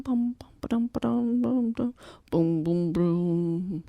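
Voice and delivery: mock drum kit; drum sounds. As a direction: drum beat imitation. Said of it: "Chi-Chi tapped".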